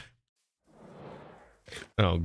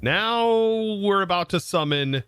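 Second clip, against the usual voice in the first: usual speaking voice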